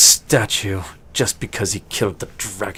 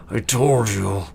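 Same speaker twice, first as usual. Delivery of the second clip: vindicated orc. Acted. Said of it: "the orc is like".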